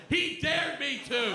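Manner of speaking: put-on high, slow child's accusation; hammed-up kid blaming the stranger; whiny kid voice. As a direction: tattle like a nine-year-old boy. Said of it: "and went".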